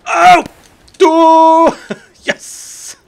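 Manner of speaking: frantic high shouts; panicked yelling. Real, not acted. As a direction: shriek in panic and apology. Panic during game mishap.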